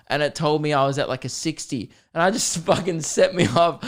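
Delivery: usual speaking voice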